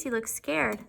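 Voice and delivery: usual speaking voice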